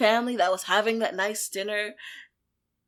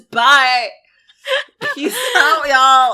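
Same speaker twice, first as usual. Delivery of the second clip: louder, laughing mock goodbye; laughing mock farewell; 6 dB louder than usual. Mocking villains who vanish from the movie, laughing. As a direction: toss off a breezy farewell while cracking up. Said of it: "They just were like".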